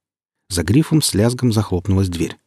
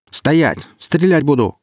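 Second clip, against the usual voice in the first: high-pitched, urgent command; restrained shout of warning; 7 semitones higher, slower than usual. A narrator's reading. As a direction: order him to halt, pitched well up. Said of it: "крикнул наёмник".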